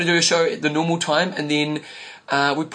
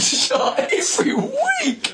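laughing; much slower than usual. Real, not acted. Laughing at wrong sign-off.

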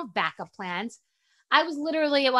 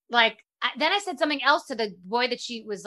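ordinary talk, nothing marked

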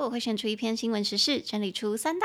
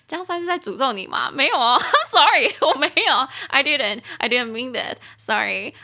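giggly, louder apology; laughing, louder sorry; 3 semitones higher, 8 dB louder than usual. Real, not acted.

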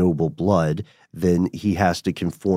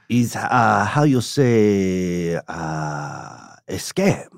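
slow, drawn-out fake European accent, stumbling for the word; a fake noble European count groping for English; mock European count accent; much slower than usual. Playing the fake Count in a joke, slow fake European accent. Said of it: "if you put on that, that accent".

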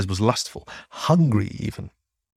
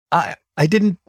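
higher, flustered stammer; 7 semitones higher than usual. A narrator's reading. Embarrassed young man to his partner, stammering. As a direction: trip over the first word in embarrassment. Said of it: "Arrow stammered".

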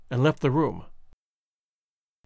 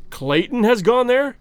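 mildly startled, higher-pitched question; chagrined exclamation; 5 semitones higher, 4 dB louder than usual. A narrator's reading. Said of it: "exclaimed Candler, visibly chagrined".

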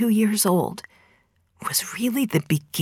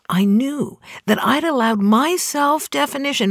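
ordinary talk

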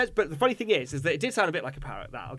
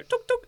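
high clicking tuk tuk; beak tapping noise. Retold or imitated. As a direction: imitate a beak tapping glass.

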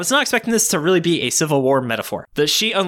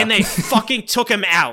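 indignant outburst; 8 semitones higher than usual. Real, not acted. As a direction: shout it in mock fury. Outrage at removed movie ad.

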